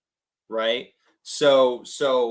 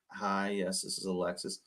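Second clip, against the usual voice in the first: softer, dropped voice to match her; 3 semitones lower, 10 dB quieter than usual. Retold or imitated. Caller mirroring a soft-spoken seller, quiet. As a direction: answer gently and low-key. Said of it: "Drop my tone".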